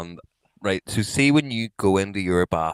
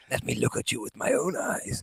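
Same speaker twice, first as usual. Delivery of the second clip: softer, breathy dying Vader line; Darth Vader dying; 3 semitones higher, 7 dB quieter than usual. Retold or imitated. Mocking friend's hungover breathing, a dying Vader rasp. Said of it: "Like fucking Darth Vader dying".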